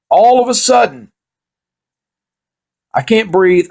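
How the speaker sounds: high, loud dramatic build; building to the punchline; mock alarm.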